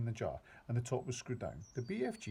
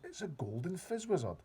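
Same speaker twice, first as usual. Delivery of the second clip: excited cry; 7 semitones higher than usual. A narrator's reading. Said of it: "he cried".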